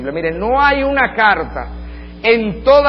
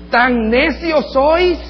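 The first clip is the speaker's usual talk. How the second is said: very slow, loud, higher scolding; scolding rebuke; 8 semitones higher, 4 dB louder, much slower than usual. Retold or imitated. Paul scolding the Galatians as fools, slow and loud. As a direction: draw out the reproach. Preacher voicing Paul's rebuke.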